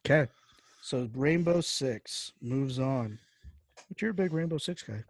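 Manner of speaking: ordinary delivery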